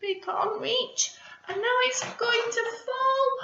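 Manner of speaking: high, worried aside; mock worry. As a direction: build the worry for the kids. Anxious aside to children.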